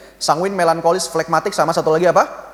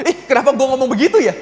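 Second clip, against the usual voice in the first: laughing regret; 5 semitones higher, 3 dB louder than usual. Retold or imitated. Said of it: "ini baru inget".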